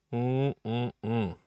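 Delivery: humming through a sip of beer; while sipping a drink. Real, not acted. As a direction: mm sounds mid-swallow. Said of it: "It's a bit hard to talk when I'm drinking beer".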